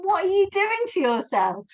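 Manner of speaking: high-pitched mock disbelief; squeaky-high, exasperated questioning; incredulous mum. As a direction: imitate a mother baffled by her daughter, pitch way up. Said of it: "she would think I'm insane".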